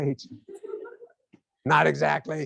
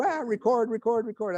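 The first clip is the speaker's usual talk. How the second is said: high-pitched frantic repeat; mock alarmed machine logging each hurt; recording device voice; 8 semitones higher than usual. Brain's recorder capturing the teacher's laughter, frantic high chant.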